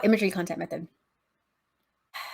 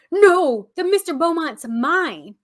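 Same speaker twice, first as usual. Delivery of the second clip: higher, louder, indignant claim; Joey protesting; 7 semitones higher, 6 dB louder, slower than usual. Retold or imitated. Joey cutting in to claim the yacht, loud and indignant. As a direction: shout it's mine like a child grabbing a toy. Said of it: "Joey chimes in and is like".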